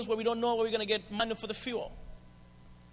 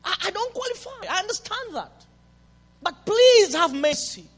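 a high, begging plea; pleading woman; 8 semitones higher, 5 dB louder than usual. Retold or imitated. Woman begging Jesus for mercy, lightly voiced. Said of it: "this woman says".

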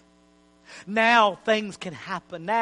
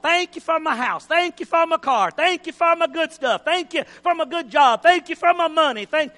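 lightly mocking higher thankful listing; shallow praise; 7 semitones higher, 4 dB louder than usual. Preacher mocking how people praise, mild mock whine. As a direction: parody a self-centred prayer, slightly higher. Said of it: "we praise like this a lot".

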